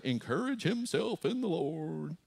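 lilting, singsong mock piety; lightly mocking, sweetly stretched words; mock pious. Preacher parodies the idea of a perfect David, mock pious.

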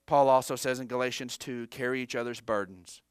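quiet, solemn reading of scripture to the congregation; hushed preaching. Acted. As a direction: bring it down low and intimate.

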